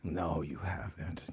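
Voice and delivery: low, slow mock-secret whisper; sly whispered denial. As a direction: breathe it like a secret. Teasing reply whispered.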